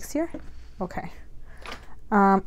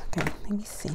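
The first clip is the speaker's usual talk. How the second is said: whispered aside to herself; hushed whisper under her breath; 8 dB quieter, faster than usual. Real, not acted. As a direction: drop to a breathy whisper while working. Fiddling quietly with package.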